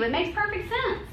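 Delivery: high mock voice of the accuser. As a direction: play the accuser in a high mocking voice, fast at the end. Also not over us saying.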